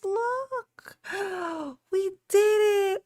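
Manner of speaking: high, tearful joy; overwhelmed happy tears; happy crying. Real, not acted. Goal met, happy crying.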